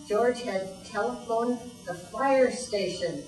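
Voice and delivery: plain reading voice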